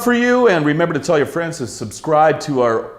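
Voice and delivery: bright, quick announcer plug; show promo read. Acted. Host plugging the show to viewers, announcer style. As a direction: sell the show to the audience.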